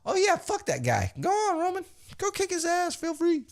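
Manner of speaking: high, silly put-on voice; mock group of wrestlers. Wrestlers urging Roman on, high mock voice. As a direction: pitch it way up as eager underlings.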